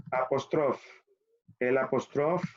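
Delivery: normal talking voice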